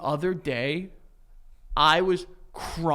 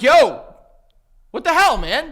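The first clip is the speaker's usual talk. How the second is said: loud, high-pitched calling-out; stern reprimand demo; 9 semitones higher, 10 dB louder than usual. Shown as a demonstration. Friend showing owner how to reprimand, loud scolding. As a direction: show him how to confront the dog. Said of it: "Just be like".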